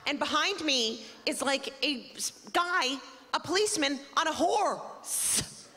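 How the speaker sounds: ordinary talk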